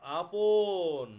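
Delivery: very drawn-out, loud word; dictating slowly. Real, not acted. Teacher dictating the next word to students, stretched out and loud. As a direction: call out the word, stretching it right out.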